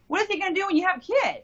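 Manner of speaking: high, fast, panicked question; alarmed warning. Retold or imitated. Mother warning her pregnant daughter about him, alarmed and fast. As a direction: press her in rising alarm.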